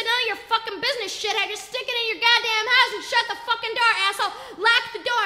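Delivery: high, loud string of orders; angry yelling. Retold or imitated. Father tells off the neighbor, imitated angry yell. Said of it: "made you yell even more".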